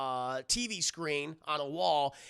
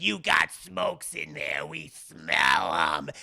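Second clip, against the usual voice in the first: slow, heavy bum voice, sinking very low; menacing bum; 4 semitones lower, 4 dB louder, much slower than usual. Retold or imitated. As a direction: lean on a mark like a thuggish bum. Voicing menacing bums in retold scene.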